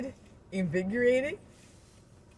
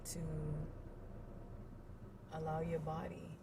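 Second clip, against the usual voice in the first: ordinary talk, nothing marked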